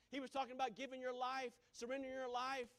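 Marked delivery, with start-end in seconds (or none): none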